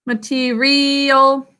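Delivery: "Material" slowly as muh-tee-REEE-ul; "Material" slow, with a long REE; syllables stretched out. Shown as a demonstration. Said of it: "third sound".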